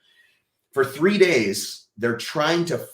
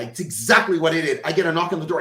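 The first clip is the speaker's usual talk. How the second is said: normal talking voice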